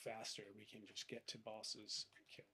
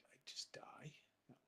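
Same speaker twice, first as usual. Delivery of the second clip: startled hushed whisper; 2 semitones higher, 7 dB quieter than usual. Real, not acted. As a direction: breathe it out in alarm. Startled whisper mid-game.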